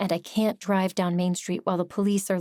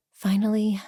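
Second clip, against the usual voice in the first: usual speaking voice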